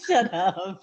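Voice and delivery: real laugh running into the words; amused, laughing telling-off; laughing protest. Real, not acted. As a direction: burst out laughing, then protest. Laughing at co-host's joke.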